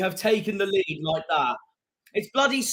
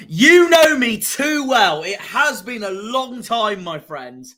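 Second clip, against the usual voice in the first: excited; 5 semitones higher, 8 dB louder, slower than usual. Real, not acted. Reading a fan's chat message.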